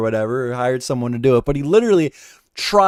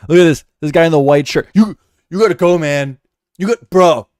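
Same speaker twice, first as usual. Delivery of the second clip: loud bouncer-bro voice, louder; frat bro; 4 semitones higher, 7 dB louder than usual. Pretend. Mocking security kicking him out.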